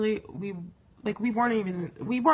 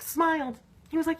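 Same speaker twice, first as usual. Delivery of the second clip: normal talking voice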